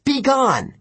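high-pitched banishing shout; commanding cry. A narrator's reading. Hero commands ghost away.

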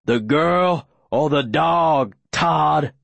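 slow, deliberate menace; scary calm. A narrator's reading. Aaron offering Todd a cruel choice, scarily calm. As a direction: lay out the threat unhurriedly. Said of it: "still with the calm that's so much scarier".

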